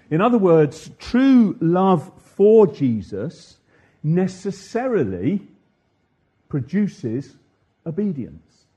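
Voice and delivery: normal talking voice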